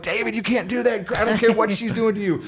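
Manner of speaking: high-pitched, acted-out pleading; retold urgent protest. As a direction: imitate frantically talking a friend down. Acted pleading with angry colleague.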